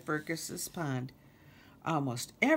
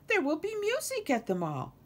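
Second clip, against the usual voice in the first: high, airy bird call; breezy mockingbird; 13 semitones higher, 3 dB louder than usual. Lulu flies away from Kermit, breezy high call.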